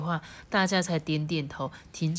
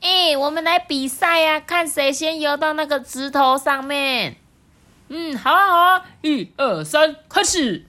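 high frog voice; 10 semitones higher, 7 dB louder than usual. Acted. Frogs racing in a story.

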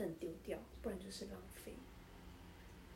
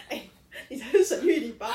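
normal talking voice

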